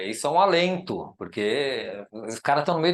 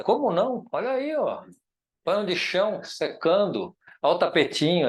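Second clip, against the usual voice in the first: excited retort; 6 semitones higher than usual. Real, not acted. Mocking critics, showing clothesline.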